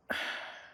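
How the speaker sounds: soft unvoiced sigh out. Companion before admitting he never belonged, soft sigh. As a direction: let out a tired breath. Turning to a heavy memory.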